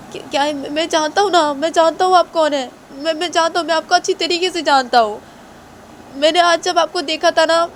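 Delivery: high desperate groveling; pleading culprit. Acted. Culprit pleads he knows him.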